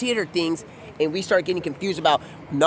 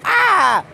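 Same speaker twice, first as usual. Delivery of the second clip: high, loud "ah" scream; mock scream; 11 semitones higher, 6 dB louder than usual. Acting out her startled reaction, mock scream. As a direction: let out a sudden high-pitched cry.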